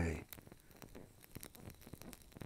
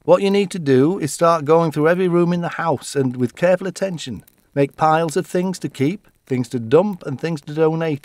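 loud, higher commanding brother; bossy orders; 5 semitones higher, 6 dB louder than usual. Retold or imitated. Brother ordering Bobby to sort every room, loud and bossy. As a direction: lay down the orders. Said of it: "he ordered".

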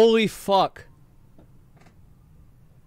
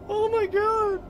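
high teary oh my god; almost sob it out in joy; overwhelmed joyful cry. Real, not acted. Overwhelmed by the drop.

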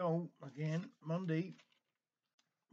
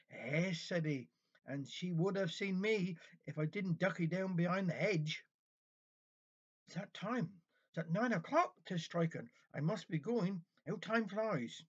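lively Cornish storyteller, a little raised; story reading; 3 semitones higher, 4 dB louder than usual. A narrator's reading. Reading a village kitchen tale to listeners, animated storytelling. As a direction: tell it as a homely dialect tale.

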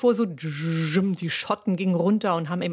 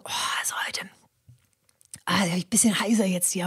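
hoarse manager; faster than usual. Retold or imitated.

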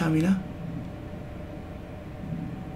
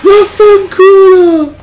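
loud, high "Vaffanculo!" stretched slow; drawn-out furious scream; 9 dB louder, much slower than usual. Real, not acted. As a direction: drag out the last scream in despair. Repeated frustrated screams at game.

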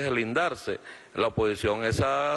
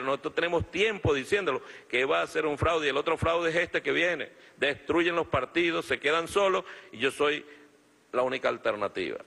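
ranting dictator speech; 4 semitones higher than usual. Real, not acted. Political rally speech.

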